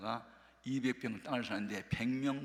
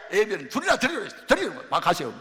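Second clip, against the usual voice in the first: loud, higher, sharp get-out; angry dismissal; 8 semitones higher, 11 dB louder, faster than usual. Retold or imitated. Woman loudly ordering the pastor to leave, angry and higher. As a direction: shove him away with the voice.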